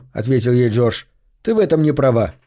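ordinary delivery, nothing marked

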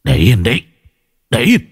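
flat, clipped refusal without warmth; cold, final command; cold and curt. Acted. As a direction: push the child away with a cold order. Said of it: "Giọng ông lạnh lùng dứt khoát".

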